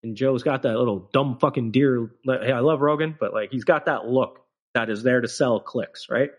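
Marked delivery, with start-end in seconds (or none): none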